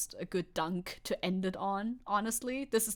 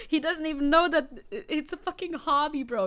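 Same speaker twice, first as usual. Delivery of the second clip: exasperated; 7 semitones higher, 4 dB louder than usual. Real, not acted. Exasperated at the lawmaker.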